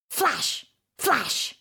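ordinary delivery

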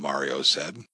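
usual speaking voice

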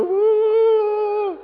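a high, frightened woo; mock fright. Shown as a demonstration. Teacher shows the class the frightened cue, high mock squeal. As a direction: squeal like you're scared. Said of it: "hold up your nails and go".

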